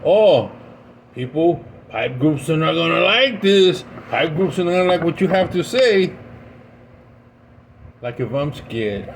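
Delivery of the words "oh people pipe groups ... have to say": loud, higher mock warning voice; mocking commenter. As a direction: ham up a worried commenter. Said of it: "the person goes".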